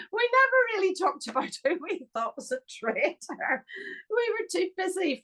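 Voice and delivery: high, squeaky laugh through the words; amused, voice lifted high by laughter. Real, not acted. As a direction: talk through giggles, pitch shooting up. Laughing over what they never discussed.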